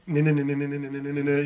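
rapid stuttering na-na-na, like a TV bionic effect; mouth-made bionic sound effect; imitated bionic sound effect. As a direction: mimic the bionic-action noise in quick nasal-consonant pulses. Mimics TV show's bionic sound effect.

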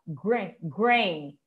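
GRAIN with the A as an "ei" glide; different A sound. Shown as a demonstration. Teacher contrasting A sounds for students, "ei" in grain. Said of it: "aqui já tá com outro sonzinho do A".

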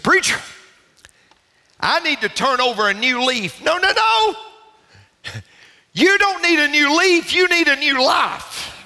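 high, ringing preacher delivery; impassioned preaching. Real, not acted. Preacher urging a congregation toward new life, impassioned. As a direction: preach it out at full pitch.

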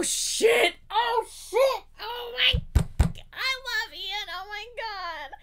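high, squealing fan reaction; thrilled, high-pitched outburst; overwhelmed excitement. Real, not acted.

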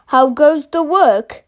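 lightly voiced lazy farmer calling out; a hint of the farmer's voice. A narrator's reading. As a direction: call it out like a lazy farmer from bed. Duck expecting farmer's call.

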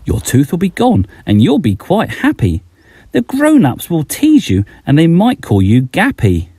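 ordinary delivery

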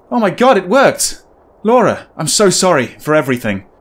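the grieving husband, suddenly hopeful; shocked joy, then a rush of apology; overjoyed hero. Acted. Reads the hero's lines.

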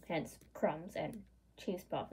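ordinary delivery

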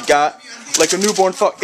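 ordinary talk

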